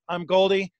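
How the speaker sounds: normal talking voice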